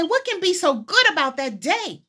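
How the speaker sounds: high-pitched, challenging acted question; incredulous questioner. Imagined churchgoer questioning why it's called Good Friday, incredulous. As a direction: voice a listener's disbelief, pitch jumping up. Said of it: "And you would say".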